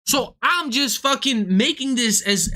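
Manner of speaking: ordinary talk, nothing marked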